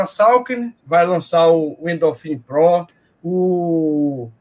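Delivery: ordinary talk, nothing marked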